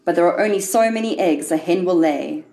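plain reading voice

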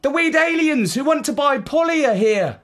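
put-on high excited kid voice; a bright young voice; child voice. A narrator's reading. Child character voice in story.

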